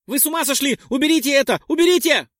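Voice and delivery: high, loud terrified shrieking; panicked scream. Acted. Dex screams at the others to remove the bug, panicked.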